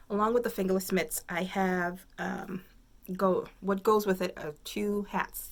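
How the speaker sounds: ordinary talk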